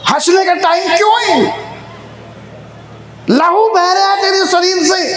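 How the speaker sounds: lightly acted alarm, voice lifted; alarmed king. King questions the bleeding maid who laughs, mildly alarmed. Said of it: "राजा तो घबरा गया".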